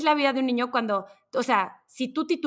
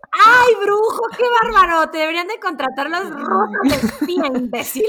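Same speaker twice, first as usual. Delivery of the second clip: high, loud mocking outburst; sarcastic yell; 7 semitones higher, 7 dB louder than usual. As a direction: blast the pilot sarcastically. (grito sarcástico al piloto)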